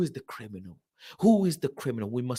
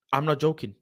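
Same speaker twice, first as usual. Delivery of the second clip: usual speaking voice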